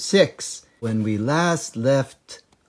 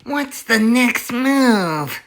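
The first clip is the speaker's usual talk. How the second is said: high, slow squeaky dwarf voice; dwarf Bunce; 13 semitones higher, 3 dB louder, slower than usual. Acted. Bunce asking his partners, high little voice. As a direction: ask it like a pot-bellied little man. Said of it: "asked Bunce".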